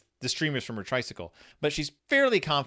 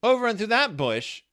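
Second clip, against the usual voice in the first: higher and louder, pointing it out; a kid sure she's found it; eager Sister Bear; 8 semitones higher, 4 dB louder than usual. Retold or imitated. Voicing Sister Bear spotting the bush, eager and high. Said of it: "Sister's like".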